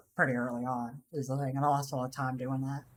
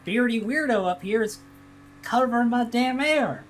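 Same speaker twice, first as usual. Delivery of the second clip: retold yell; 8 semitones higher, 5 dB louder than usual. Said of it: "and I'm like".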